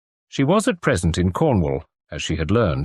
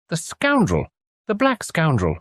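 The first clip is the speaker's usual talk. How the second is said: outraged; 8 semitones higher than usual. Acted. Mrs Rymer reads of the plot.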